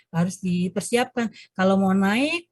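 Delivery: usual speaking voice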